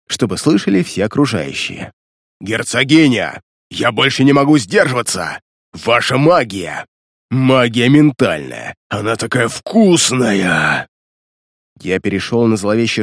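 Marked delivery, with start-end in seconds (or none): 2.4-10.9 slow, hungry undead growl; menacing crazed creature; Tobard's crazed voice. Acted. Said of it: "громко и грозно крикнул голосом Тобарда".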